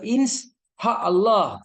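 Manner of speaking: "ins" cut off, then "ha Allah" with the "sy" dropped; mock a wrong "ha Allah" with no "sy"; wrong pronunciation. Shown as a demonstration. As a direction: mimic the faulty "ins, ha Allah". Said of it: "bukan begitu penyebutannya".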